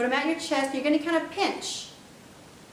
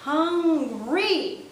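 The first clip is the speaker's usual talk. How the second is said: Hungry drawn out, higher and louder; emphatic hungry; 4 semitones higher, 3 dB louder than usual. Shown as a demonstration. Teacher stressing Hungry for very hungry. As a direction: stretch Hungry to show real hunger. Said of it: "you can slow it down 'cause that emphasizes it".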